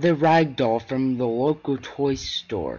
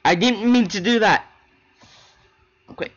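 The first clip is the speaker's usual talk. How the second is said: louder, higher, rushed real apology for a slip; flustered apology; 6 semitones higher, 6 dB louder than usual. Real, not acted. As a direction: blurt out sorry after fumbling the line. Flustered outtake apology, laughing off mistake.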